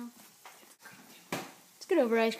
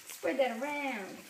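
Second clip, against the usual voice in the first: ordinary talk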